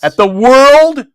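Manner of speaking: slow, loud, drawn-out World; dramatic emphasis. Acted. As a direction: stretch out World.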